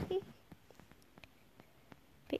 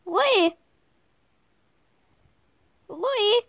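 calling out; 8 semitones higher, 6 dB louder than usual. Real, not acted. Calling a player by name.

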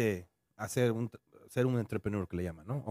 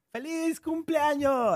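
high, loud, slow birthday cheer; cheerful birthday shout; 5 dB louder, much slower than usual. Retold or imitated. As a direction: belt it out like a party surprise. Acting out a birthday surprise.

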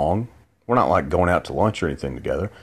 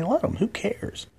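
dismissive shrug; 6 dB quieter, slower than usual. Real, not acted. Dismissive aside, high then breathy.

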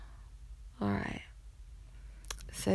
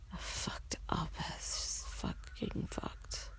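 whispering; 13 dB quieter than usual. Real, not acted. Whispered confession of breakdown.

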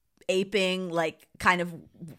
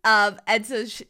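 ordinary talk, nothing marked

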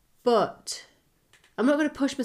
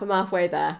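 usual speaking voice

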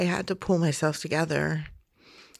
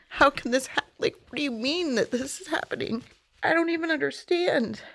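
mild mock of a panicked plea; reliving the shock of disbelief; retold distressed outcry; 9 semitones higher, faster than usual. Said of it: "screamed at my friend's husband".

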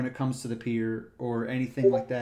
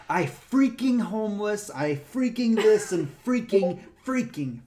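higher, slow mock rant; 7 semitones higher, 3 dB louder, slower than usual. Cyclist ranting at the ranger, slow higher mock rant. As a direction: drag out each angry freaking. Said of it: "trying to tear into me with freaks".

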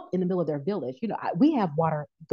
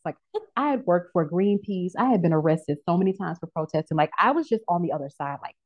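ordinary delivery

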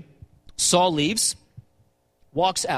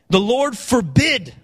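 slow, louder and higher on forbid; solemn emphasis; 5 semitones higher, 5 dB louder, slower than usual. Acted. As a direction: lean hard into forbid like a vow. Reading David's solemn oath aloud.

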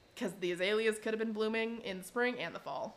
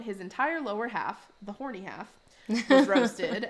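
usual speaking voice

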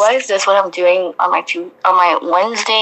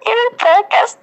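laughing; 10 semitones higher than usual. Real, not acted. Reading through laughter.

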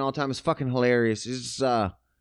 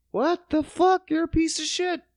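outraged; 11 semitones higher than usual. Retold or imitated. Venting at former friend.

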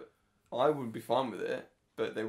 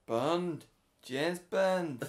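loud, higher mock Scouse; Bond's catchphrase in badly faked Scouse; terrible Liverpool accent; 6 semitones higher, 6 dB louder, much slower than usual. Co-host tries Bond as a Liverpudlian, terrible Scouse accent. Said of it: "That was a really terrible accent".

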